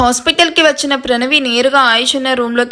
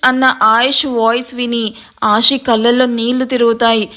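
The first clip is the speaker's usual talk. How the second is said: plain reading voice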